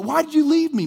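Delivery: high, loud accusing question; angry grief. Acted. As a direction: act out a mourner's angry outcry. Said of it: "there's some anger in it".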